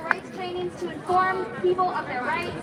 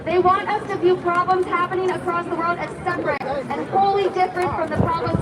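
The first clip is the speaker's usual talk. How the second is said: impassioned rally speech; fiery revolutionary speech; 5 dB louder than usual. Acted. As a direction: rouse the crowd. Rally speech against enemies.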